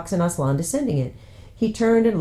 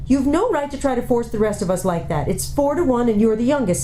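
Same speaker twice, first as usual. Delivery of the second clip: put-on higher, scolding older girl; scolding older sister; 8 semitones higher than usual. Older sister scolding youngest sister, reproachful. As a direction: put her in her place.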